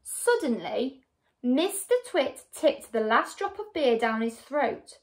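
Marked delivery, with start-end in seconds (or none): none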